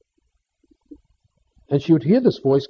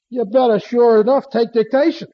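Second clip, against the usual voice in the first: higher-pitched, insistent retold advice; emphatic urging; 7 semitones higher than usual. Psychiatrist advising his colleague to take dictation, emphatic urging. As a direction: deliver it as a raised-pitch punchline command.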